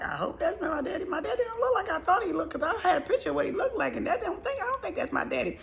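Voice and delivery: high, quick worried thought; doubtful inner voice. Retold or imitated. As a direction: think it aloud in a small, high voice. Acting out her doubting younger self.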